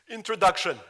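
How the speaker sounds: put-on loud, high announcing in a Russian accent; proudly announcing the title, Russian-accented; child's announcement, Russian accent. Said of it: "the Russian accent is still thick".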